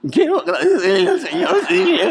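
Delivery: very high strained tenor squeak; nervous voice jumping way up high. Retold or imitated. Nervous speaker squeaking out thanks to the Lord in a high voice. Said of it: "yo con una voz así de tenor digo".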